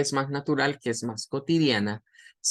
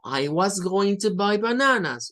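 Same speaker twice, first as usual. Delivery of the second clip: I was going to buy bananas with a sing-song rhythm, higher; rhythmic weak forms; 5 semitones higher than usual. Shown as a demonstration. Teacher showing English rhythm. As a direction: lilt through the weak forms. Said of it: "no es lo mismo decir esto con ritmo".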